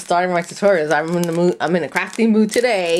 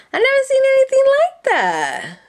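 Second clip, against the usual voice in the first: very high, louder amazed squeal; 5 dB louder than usual. Real, not acted. Crafter amazed by a new trim, high squeal.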